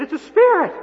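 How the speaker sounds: the disciples panicking at a ghost on the water; frightened cry. Acted. Frightened disciples see Jesus on water.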